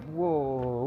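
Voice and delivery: usual speaking voice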